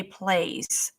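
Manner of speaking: ordinary talk, nothing marked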